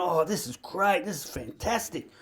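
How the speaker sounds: quietly delighted mother, barely voiced; mum reading. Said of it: "looking at the magazine like this and going".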